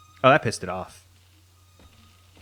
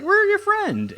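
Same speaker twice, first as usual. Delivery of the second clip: much higher, louder, sing-song and eager; mock friendly squeal; 5 dB louder, slower than usual. Player voicing a reply to the creature, high mock-friendly squeal. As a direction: squeak it out like an overeager pal.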